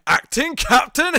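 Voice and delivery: slow, loud, high incredulous question; Picard's outraged disbelief. Retold or imitated. Picard reacting to the acting captain announcement, aghast. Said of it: "Picard just goes".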